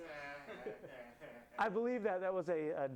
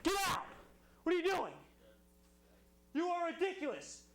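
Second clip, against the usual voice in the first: angry screaming rant; 8 semitones higher than usual. Acted. Host reads Martinson letter angrily.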